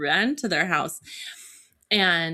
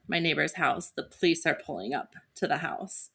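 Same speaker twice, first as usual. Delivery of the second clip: normal talking voice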